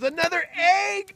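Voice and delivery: very high, loud announcement; excited cry. Acted. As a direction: crow it out at full volume. Rooster announces second egg.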